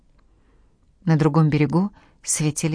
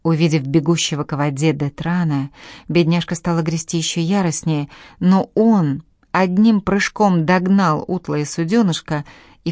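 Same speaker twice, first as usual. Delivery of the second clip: plain reading voice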